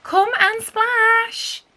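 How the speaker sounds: slow, high, drawn-out coaxing; pleading call. A narrator's reading. Anna coaxing her cousin toward the waves, slow high plea. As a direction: stretch each word to lure her in.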